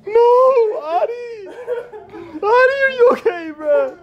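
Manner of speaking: shrieking through laughter; yelling while cracking up; laughing shout. Real, not acted. Friend hit by fart, laughing panic.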